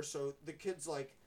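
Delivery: usual speaking voice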